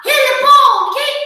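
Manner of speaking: high, loud shout from the stands; loud cheering yell. Retold or imitated. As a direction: cheer like a mom yelling at her kid's game. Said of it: "she yelled real loud".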